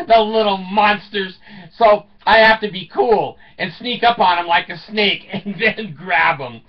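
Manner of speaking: usual speaking voice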